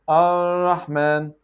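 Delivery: slow 'Ar-Rahman', R held untapped; hanging tongue R. Shown as a demonstration. Teacher recapping mistakes, hanging R. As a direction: stretch the R of 'Ar-Rahman' without touching. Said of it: "you don't hang the tongue".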